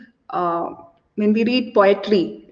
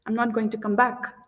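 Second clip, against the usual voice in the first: much softer, dropped, final refusal; quiet refusal; 8 dB quieter than usual. Acted. Woman turning away the man, softly final. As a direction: let it fall away to a cold hush. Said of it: "put in more sarcasm".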